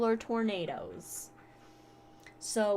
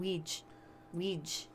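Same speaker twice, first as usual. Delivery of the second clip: New Zealand accent; 8 dB quieter than usual. Shown as a demonstration. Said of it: "that's my New Zealand accent".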